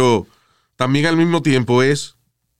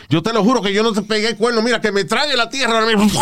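lightly voiced pleading boyfriend, higher; 8 semitones higher than usual. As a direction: swear it like a guilty boyfriend. Novio jurando a la novia. (novio suplicando, voz algo más aguda)

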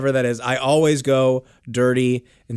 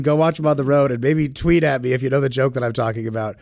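ordinary delivery

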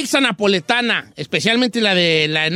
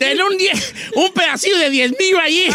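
laughing; 6 semitones higher than usual. Retold or imitated. Retelling Chapis, laughing.

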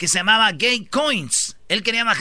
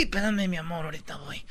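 very soft, confidential aside; mock secret murmur to a sweetheart; hushed aside; 3 semitones lower, 10 dB quieter than usual. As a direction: drop to a near whisper for a lover. Acts aside to his new lover.

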